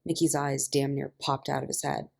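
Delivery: plain reading voice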